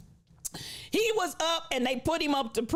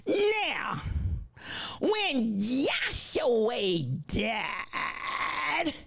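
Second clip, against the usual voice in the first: slow, drawn-out nasal preacher intoning; a solemn nasal pulpit drone, very slow; 3 semitones lower, much slower than usual. Retold or imitated. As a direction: preach it nasally, dragging every word. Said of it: "He talked real nasal-like".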